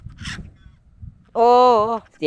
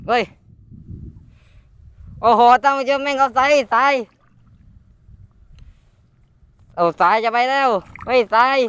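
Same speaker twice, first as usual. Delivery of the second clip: alarmed excitement; 6 semitones higher than usual. Real, not acted. Line running out on fish.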